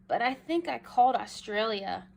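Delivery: ordinary delivery, nothing marked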